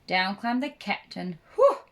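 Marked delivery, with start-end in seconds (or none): 1.6-1.8 relieved whew. A narrator's reading. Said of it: "said the dog".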